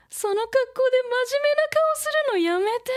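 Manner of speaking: very high, loud, fast squeal of protest; acted anime protest. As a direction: squeal the retort like an anime girl. Acted retort in character dialogue.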